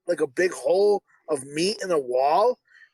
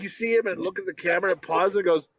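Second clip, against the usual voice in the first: ordinary talk, nothing marked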